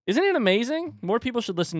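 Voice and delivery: very high excited squeal; gushing fan squeal. Real, not acted. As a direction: squeal it with delight. Gushing about podcast.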